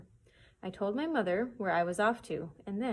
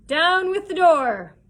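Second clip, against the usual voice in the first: much higher, much louder, slow bellow; blind man's cry; 10 semitones higher, 14 dB louder, slower than usual. Acted. Blind pirate ordering his men in, bellowed slow. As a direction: roar the order to break in. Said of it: "heard the blind man's voice cry".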